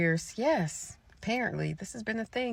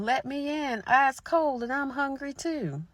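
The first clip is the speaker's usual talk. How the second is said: eerie pleading voice; 7 semitones higher, 4 dB louder than usual. Acted. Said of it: "Then the voice said again".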